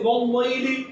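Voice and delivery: slow "واللیل" with a heavy, dark lam; wrongly thickened lam. Shown as a demonstration. Teacher showing a common misreading, thick lam. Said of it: "اس لام کو کیوں موٹا کر رہے ہو".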